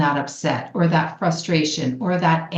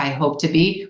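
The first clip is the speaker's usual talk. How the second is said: ordinary talk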